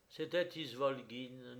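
usual speaking voice

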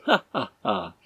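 acted scornful laugh; mocking laugh. A narrator's reading. Stranger laughing at Arthur's question, mocking. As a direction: laugh it out as separate ha-ha-has.

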